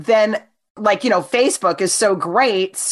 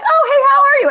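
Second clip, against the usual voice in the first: very high, louder, sugary mock greeting; fake sweet greeting; 6 dB louder, faster than usual. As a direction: play her phony friendliness. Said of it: "she private messages me like".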